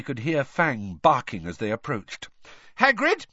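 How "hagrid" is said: high, loud call; shouting. A narrator's reading. Hermione pounding on Hagrid's door, shouting. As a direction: call through a closed door. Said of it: "Hermione shouted".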